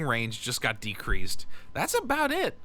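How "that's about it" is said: high squeaky voice. Pretend.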